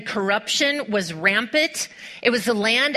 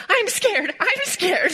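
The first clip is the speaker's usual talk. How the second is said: higher, playful scared whimper; mock scared squeal; 9 semitones higher, slower than usual. Preacher imitating her own fear at the chute, higher mock scared voice. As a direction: pretend to be a frightened kid. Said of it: "I was like".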